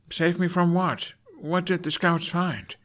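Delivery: put-on high, urgent teen voice; a son pushing for answers; young Hiccup demanding.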